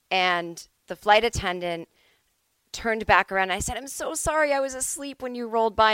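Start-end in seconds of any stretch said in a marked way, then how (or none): 3.8-5.9 higher-pitched, rushed apology to a stranger; apologetic. Retold or imitated. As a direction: act out an apology in a raised voice. Acted apology to flight attendant.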